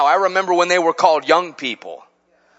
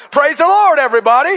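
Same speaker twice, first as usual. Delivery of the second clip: West Virginia accent; 9 semitones higher, 6 dB louder, faster than usual. Shown as a demonstration. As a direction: lead the church like a West Virginia song leader. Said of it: "Pretend I'm from West Virginia right now".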